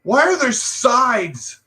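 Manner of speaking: put-on high, slow bratty kid voice; mocking childish politicians. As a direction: sneer it like a spoiled child. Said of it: "arguing like little kids".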